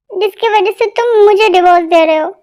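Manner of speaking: normal talking voice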